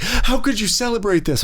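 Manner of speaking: high, hammed-up accusing whine; mock furious fan. Imitating furious fans in the theater, high mock outrage. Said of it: "Be like".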